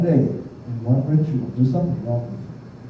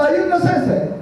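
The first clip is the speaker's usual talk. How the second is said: high voice, politely asking for the sensei; lightly voiced Samoan guy; big Samoan guy asking; 13 semitones higher than usual. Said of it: "they come to my room and say".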